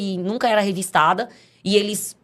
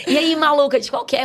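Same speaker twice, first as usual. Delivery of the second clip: mocking friends; 9 semitones higher, 3 dB louder than usual. Old friends calling her nickname.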